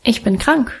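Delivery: self-assured. A narrator's reading. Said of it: "entgegnete ich noch selbstbewusster".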